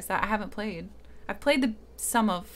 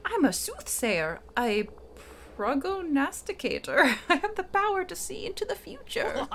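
put-on Merlin old man voice; 6 semitones higher than usual. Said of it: "my old man voice".

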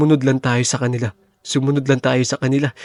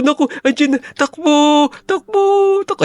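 much higher, loud shriek of alarm; panicked yell to run; 14 semitones higher, 3 dB louder than usual. Acted. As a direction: scream at everyone to flee. Seeker arrives, yells to run. (sigaw sa takot, napakataas)

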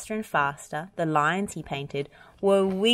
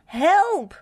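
very high, loud cry for help; runaway tractor roaring for help; 13 semitones higher, 8 dB louder than usual. Acted. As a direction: bellow in panic. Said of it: "roared Travis".

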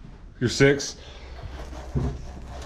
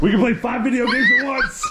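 excited; 13 semitones higher, 4 dB louder than usual. Real, not acted. Counting TVs with excited child.

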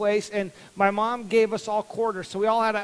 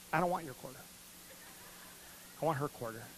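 soft, lower pouty refusal; boy refusing mom's quarter, softer and lower; sulky refusal; 5 semitones lower, 13 dB quieter, faster than usual. Retold or imitated. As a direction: refuse like a sulking kid. Stubborn sulking refusal.

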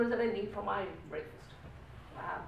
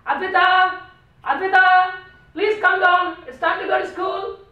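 loud shout upstairs; calling out; 11 semitones higher, 19 dB louder, slower than usual. Retold or imitated. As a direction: call her down, loud and high. Father calling daughter downstairs.

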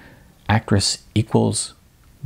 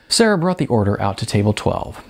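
ordinary delivery

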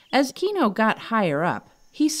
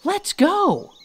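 excited; 12 semitones higher, 5 dB louder than usual. Acted. Excited monkey urging friend.

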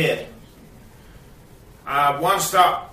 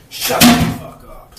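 angry rasp; 5 semitones higher, 5 dB louder than usual. Real, not acted. Snapping at himself.

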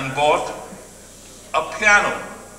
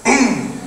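a sudden, higher boom imitating the volcano; explosion boom; 7 semitones higher, 4 dB louder than usual. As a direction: burst out the boom like an eruption. Volcano erupting in the story.

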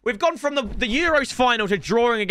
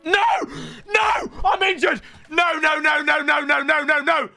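despairing shout; 5 semitones higher than usual. Real, not acted. His player gets injured.

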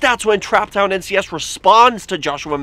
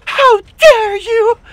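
much higher, louder, slow outraged how dare you; mock a furious activist, drawn out and high; Greta Thunberg; 8 dB louder, slower than usual. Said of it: "I just became Greta Thunberg".

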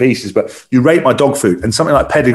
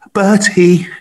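higher, cooing the dog's name; wife cooing; 8 semitones higher than usual. Retold or imitated. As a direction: call the puppy sweetly, pitched up. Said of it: "She's like".

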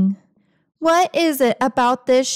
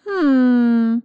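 ordinary delivery

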